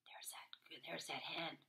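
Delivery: soft whispered aside. Real, not acted. Reader whispers to the child. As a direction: point it out, hushed.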